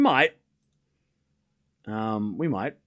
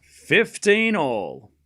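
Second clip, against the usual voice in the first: slow drawn-out score call; much slower than usual. Acted. Calling the score again, drawn out.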